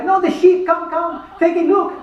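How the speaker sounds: high, loud hammed-up Greek accent; a terrible Greek tour guide impression, loud and high. Greek guide greeting tourists off the plane, hammed-up Greek accent. Pappus voice again.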